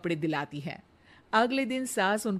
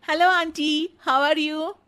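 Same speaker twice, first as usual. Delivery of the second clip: higher, louder friendly hello; bright greeting; 8 semitones higher, 7 dB louder, slower than usual. Acted. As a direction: greet the neighbour cheerfully. Radha greets a lady.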